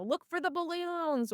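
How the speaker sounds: high, light singsong call; singsong announcement. Retold or imitated. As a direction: sing out a cheery pointer to guests. Quoting her own announcement.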